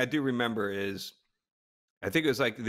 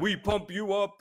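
slow, higher mock bodybuilder voice; Hans and Franz; 8 semitones higher, slower than usual. Quoting the Christmas party skit, mock bodybuilder voice. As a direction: pump them up like Hans and Franz. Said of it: "Hans and Franz from Saturday Night Live".

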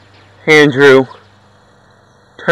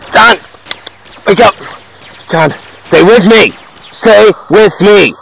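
begging him to hold on; pleading with a dying friend to stay awake; 7 semitones higher than usual. Acted.

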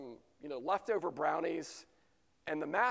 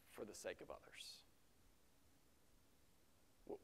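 much softer, lower, near whisper; hushed earnest preaching; 9 semitones lower, 16 dB quieter than usual. Real, not acted. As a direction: drop to an intense hush. Hushed sermon climax.

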